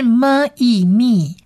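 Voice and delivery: 么、 E、咪 as M, then E, then blended MI; sounding out syllables. Shown as a demonstration. Teacher blending mem with its vowel, 么、 E、咪 sound by sound. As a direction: give 么、 E、咪 piece by piece, joining at the end. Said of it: "这是一个短母音E的音".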